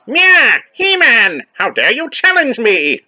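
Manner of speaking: high, loud shrieking villain; shrill villain voice. Acted. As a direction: meow then outrage, high and shrill. Corrected reading of Skeletor.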